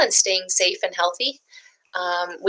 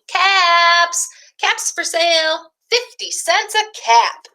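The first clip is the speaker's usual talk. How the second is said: higher sing-song street call; calling out wares; 10 semitones higher, slower than usual. Acted. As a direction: call out caps like a peddler selling. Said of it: "walked back down to town calling".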